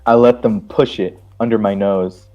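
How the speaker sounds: usual speaking voice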